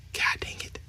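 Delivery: real whispered curse to oneself; barely audible, exasperated aside; muttered frustration. Real, not acted. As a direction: whisper it under your breath. Annoyed at forgetting a film number.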